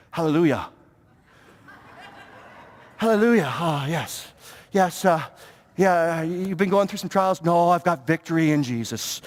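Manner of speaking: mock-holy churchgoer, pitched well down; saintly mode. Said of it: "we step into the saintly mode".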